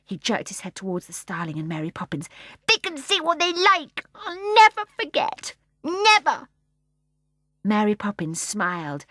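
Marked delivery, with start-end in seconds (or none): 2.7-6.5 put-on high, loud fierce child; baby boy vowing defiantly, high and loud. A narrator's reading. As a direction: swear it with a baby's fury. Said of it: "softly and fiercely".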